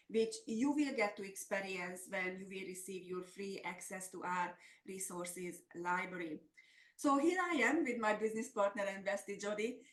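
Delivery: usual speaking voice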